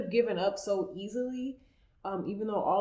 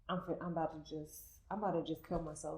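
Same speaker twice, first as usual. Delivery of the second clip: defeated mutter; 3 semitones lower, 11 dB quieter, faster than usual. Retold or imitated. Retold despair over bad news.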